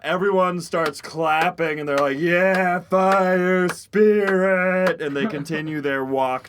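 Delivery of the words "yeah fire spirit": cheering crowd. Acted. Crowd cheering fire spirit.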